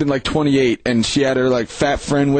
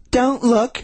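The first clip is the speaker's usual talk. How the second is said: higher mock voice; mock girl; 8 semitones higher than usual. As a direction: squeak it out like her. Quoting the girl in woods.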